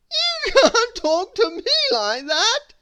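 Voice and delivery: high, loud sobbing protest. A narrator's reading. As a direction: sob the complaint. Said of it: "sobbed the king".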